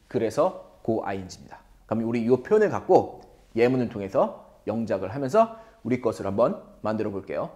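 usual speaking voice

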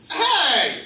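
high, loud cry; startled yelp. Real, not acted. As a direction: yelp in sudden pain. Getting hurt by bayonet.

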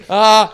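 loud, high drawn-out "ah"; tongue-tied wail, very loud; stuck, wordless moan at full volume; flustered noise. Retold or imitated. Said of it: "these are the noises I was making".

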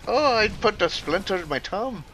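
high Irish lilt, TH said as a plain T; Irish accent. Shown as a demonstration. Showing chat how Irish speakers talk, high Irish accent. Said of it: "you don't say T H right".

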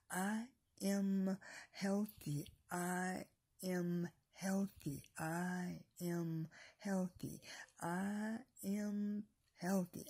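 slow, deliberate affirmations, some breathed almost in a whisper; affirmation demo. Shown as a demonstration. Preacher showing viewers how to speak blessings, slow and deliberate. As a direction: say each affirmation slowly, like you mean it.

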